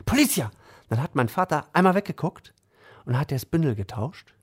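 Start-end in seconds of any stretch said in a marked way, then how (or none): 0.1-0.5 alarm call. Retold or imitated. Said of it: "Und dann hat er nur gesagt".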